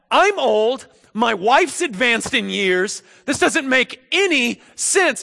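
high, loud, slow objections; incredulous protest. Acted. Zechariah lists why the promise is absurd, incredulous protest. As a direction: list the reasons in loud disbelief.

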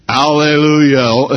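"Hallelujah" called out loud and slow, rising at the end; proclaimed hallelujah. Shown as a demonstration. Preacher evoking heaven's crowd, loud rising "Hallelujah". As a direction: ring out "Hallelujah" like a praising crowd. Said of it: "Heaven now is rumbling with hallelujah".

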